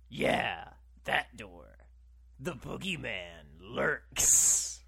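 low, slow, haunting horror voice; spooky voice. Retold or imitated. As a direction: drag it out like a haunted-house announcer. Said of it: "there's this spooky voice that's like".

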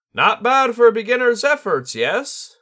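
high, loud bird spirit voice; a proud bird master teasing his pupil; tengu sensei. A narrator's reading. Tengu sensei appraising the sword in a high, loud voice.

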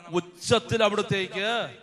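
ഉച്ചത്തിൽ proclaimed slowly and full-voiced; said aloud. Shown as a demonstration. Preacher showing how ഉച്ചത്തിൽ should sound, slow and proclaimed. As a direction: declare it out loud, slowly. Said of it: "ശബ്ദം ഉയർത്താതെ എങ്ങനെ ഉച്ചത്തിൽ പറയാ".

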